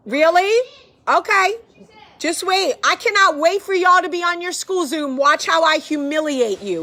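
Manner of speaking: high, loud teasing threat; loud playful threat. Real, not acted. Mom yelling teasing threats at her noisy kids, loud.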